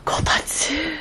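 breathless whisper of delight; awed whisper. Real, not acted. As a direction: whisper the word in awe. Raw reaction, whispered.

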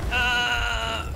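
long high "ah"; cry under pressure. Real, not acted. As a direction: let out a drawn-out high cry.

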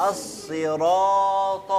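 الصراط with a slow, heavy emphatic saad. Shown as a demonstration. Teacher drilling students on the emphatic saad, slowly. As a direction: draw out the thick saad in الصراط. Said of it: "الصراط الصاد ده حقها".

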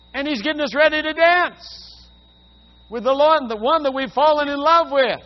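loud, high, ringing preaching; full-force preaching. Acted. Preacher proclaiming to the congregation, loud and high. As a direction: lift the whole room with joy.

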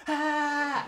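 one high, loud shriek; a terrified child's scream; kids screaming. Retold or imitated. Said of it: "they're just screaming".

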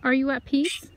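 loud question, rising at the end; calling out. Real, not acted. Visitor asking spirits if at peace, calling out. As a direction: project a sincere question to the spirits.

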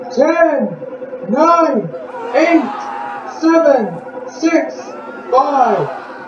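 high, excited countdown shout; new year countdown crowd. Acted. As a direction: hype everyone up for midnight.

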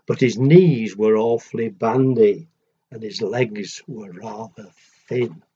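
gentle rhythmic read-aloud; storybook reading. A narrator's reading. Parent reading a picture book to a child, sing-song rhyme.

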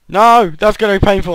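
high, loud cry as the fall begins; frustrated yell. Real, not acted. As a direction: shout it out while falling. Falls from high up.

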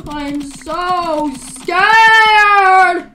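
hammed-up fear, stretched very slow; drawn-out wail. Player playing up being scared, in a long drawn-out wail. Same words built up to a wail.

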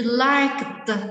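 "liked" with k and "tờ" sounded apart; đuôi -ed đọc /t/. Shown as a demonstration. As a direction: release a clear, loud t ending on "liked". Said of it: "có ba cách phát âm đây". (đọc to "liked", bật rõ k rồi tờ)

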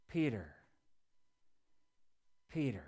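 low, soft, sighing Peter, Peter; Jesus frustrated. Retold or imitated. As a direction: let the name drop in tired disappointment, head going down. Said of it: "the frustrated sigh, shaking his head".

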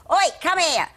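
high, loud cheeky "Oi"; loud call. Retold or imitated. Wardrobe mistress calling the girl over, loud and high. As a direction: summon her across the room. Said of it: "play that wardrobe mistress".

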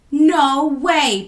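loud, high refusal; scared girl refusing a spooky story; protesting. A narrator's reading. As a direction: shoot the idea down hard. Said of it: "protested Equia".

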